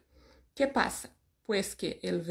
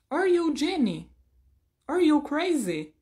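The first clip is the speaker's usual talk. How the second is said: bright teacher demo; 6 semitones higher, 3 dB louder than usual. Shown as a demonstration. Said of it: "y yo digo".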